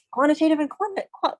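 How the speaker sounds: tongue-tied stumble. Real, not acted. Teacher tripping over a term in class, tongue-tied. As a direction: stumble over the word and break off. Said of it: "I can't say it".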